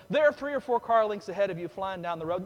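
usual speaking voice

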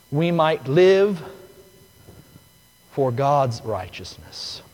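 slow, loud preacher's climax; full-force preaching. Acted. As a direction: proclaim it to the whole church.

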